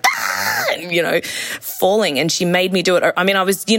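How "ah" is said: high yelp of fear, retold; mock scream. Letting go of the trapeze, high mock scream. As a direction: squeal it as if letting go. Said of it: "and being like".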